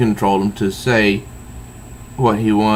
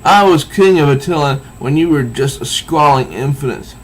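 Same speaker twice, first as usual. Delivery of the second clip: higher, louder haughty outrage; uptight indignation; 6 semitones higher, 5 dB louder than usual. Retold or imitated. Old king scolding Maximus, mock outrage. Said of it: "all uptight".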